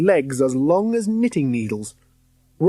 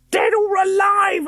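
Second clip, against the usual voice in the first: high loud shriek of dead or alive; shrieking ghost hunter; 4 dB louder than usual. A narrator's reading. Hunter shrieking the chapter's words. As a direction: screech it like a hag. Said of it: "He heard her shriek".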